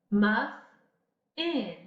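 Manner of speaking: slow Muff-in with a break at the f; split into syllables. Shown as a demonstration. Teacher pulling Muff-in apart to find the middle sound. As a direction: pause inside Muff-in at the f. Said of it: "does it have one medial consonant sound?".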